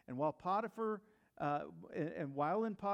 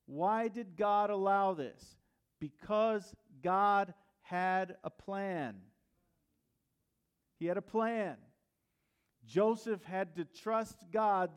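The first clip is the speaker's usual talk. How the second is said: slow, louder, each word hammered from the pulpit; full-force preaching; 6 dB louder, slower than usual. Real, not acted. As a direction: lean in and preach it slowly. Preacher drives home point.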